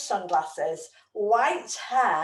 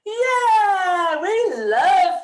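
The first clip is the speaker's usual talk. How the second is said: excited cheer; 7 semitones higher, 6 dB louder, much slower than usual. Real, not acted. Cheer after the song ends.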